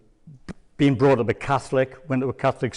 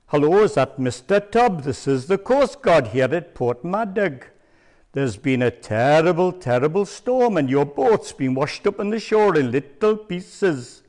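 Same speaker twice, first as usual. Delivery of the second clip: prank Welsh coast guard voice, pitched higher; Welsh accent; 3 semitones higher than usual. Retold or imitated. Prank caller phoning his friend as a Welsh coast guard. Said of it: "please excuse the Welsh accent".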